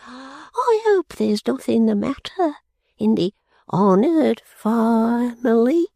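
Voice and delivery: high, slow, lightly put-on old man; creaky old pauper asking politely; old Nandy concerned for the family. Voices a character in reading.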